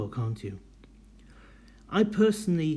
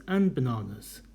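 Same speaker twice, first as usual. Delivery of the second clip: and bananas falling to the end; falling intonation. Shown as a demonstration. Teacher repeating the falling end of the list. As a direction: let and bananas drop down. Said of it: "Your intonation goes down".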